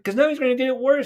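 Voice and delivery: high, hurried protest; worried protest. Retold or imitated. She explains why he shouldn't have called, high worried protest. Retold anxious protest in argument.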